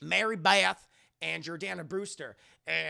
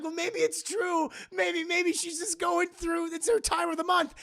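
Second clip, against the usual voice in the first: naive defender; 11 semitones higher, 5 dB louder than usual. Retold or imitated. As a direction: plead her case like a hopeful dupe. Casey naively defends her.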